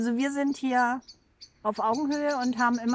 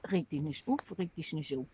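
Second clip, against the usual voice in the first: put-on lower-pitched Hessian accent; Hessian dialect; 6 semitones lower, 6 dB quieter than usual. Co-host repeating the calming phrase to the other, low Hessian dialect. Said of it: "Was, hessisch?".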